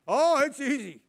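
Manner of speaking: loud, high mock-breezy boast; mocking naive claim. Mocking childless people's claim, loud breezy boast. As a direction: mimic the smug childless know-it-all. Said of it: "people have no kids".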